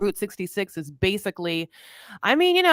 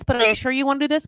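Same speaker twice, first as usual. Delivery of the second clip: anxious pressing; 6 semitones higher, much faster than usual. Retold or imitated. Puppy anxiously pressing reluctant Eric.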